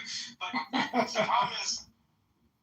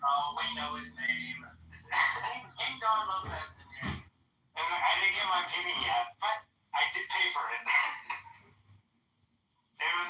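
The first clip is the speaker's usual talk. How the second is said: ordinary talk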